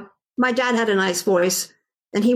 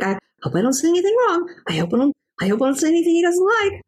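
higher, rushed anxious self-talk; nervous inner voice; 7 semitones higher, much faster than usual. Retold or imitated. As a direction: fret quickly, acting out nerves. Nervous inner thoughts before teaching.

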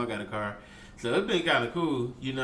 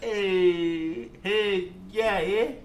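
higher mock Canadian, nearly Irish; badly faked Canadian accent; 4 semitones higher, much slower than usual. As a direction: try out a shaky attempt at a Canadian accent. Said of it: "That's like an Ireland, Irish accent".

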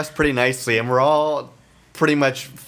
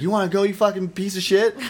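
higher-pitched, goading mock threat; pitched-up taunt, not truly shouted; retold fight challenge; 7 semitones higher than usual. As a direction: imitate someone squaring up for a fight. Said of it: "the biker initiates it".

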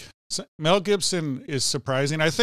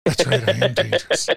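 louder, hammed-up menacing delivery; menacing movie line; 5 dB louder than usual. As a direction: growl it like a movie tough guy. Acting out the villain's reply.

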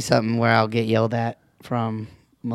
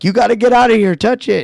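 higher, louder urgent warning; 9 semitones higher, 7 dB louder than usual. Retold or imitated. Harry Potter urging a friend, in a raised hurried voice. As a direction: warn him to get out, fast. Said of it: "Harry Potter looks around and goes".

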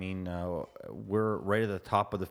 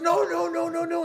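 very high, louder no no no; much higher rabbit squealing no; frantic rabbit; 6 dB louder than usual. Acted. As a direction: shriek it in panic. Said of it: "the rabbit goes".

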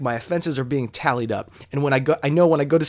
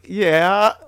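high, loud, mock-trembly reply rising at the end; 6 semitones higher, 6 dB louder than usual. Showing how he answered the cop, trembly voice. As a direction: act out a nervous, wobbling answer. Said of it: "With my voice all trembly".

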